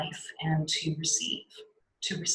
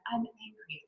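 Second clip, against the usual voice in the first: soft, timid admission of anger; held-back anger; 5 semitones higher, 10 dB quieter than usual. Shown as a demonstration. Teacher mimicking anger that won't express itself, softly held back. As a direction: say it small and meek, barely daring. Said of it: "instead of like".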